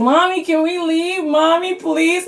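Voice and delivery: put-on high, slow whimpering toddler voice; little son begging mommy, high and drawn out; toddler pleading. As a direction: beg like a scared little boy to leave. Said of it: "my son starts crying".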